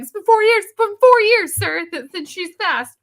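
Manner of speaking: high, loud, incredulous lecture; exasperated. Retold or imitated. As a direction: talk down to the dad in disbelief. Exasperated at the dad.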